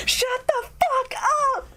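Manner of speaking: high, slow mock kid yell; child shouting. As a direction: yell it like a fed-up kid on a bus. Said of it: "he just shouts to the entire bus".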